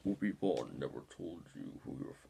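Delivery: deep-voice Vader try that breaks off; Darth Vader impression. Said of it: "I can do an impression of him right now".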